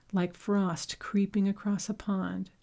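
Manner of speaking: plain reading voice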